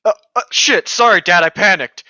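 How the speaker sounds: panicked apology. Acted. Edward apologising to Dad.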